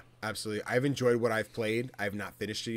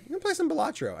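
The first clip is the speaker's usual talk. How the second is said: excited; faster than usual. Retold or imitated. Excited quote of himself.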